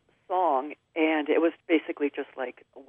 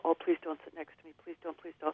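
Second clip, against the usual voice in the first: quiet pleading; 9 dB quieter than usual. Retold or imitated. Dreading a stranger on the bus.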